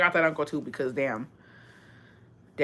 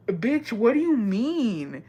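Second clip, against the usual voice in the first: offended drawl; 2 semitones higher, much slower than usual. Retold or imitated. Offended comeback.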